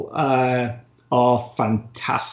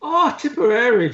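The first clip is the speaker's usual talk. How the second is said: put-on Indian accent; 10 semitones higher than usual. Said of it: "I thought she was from India".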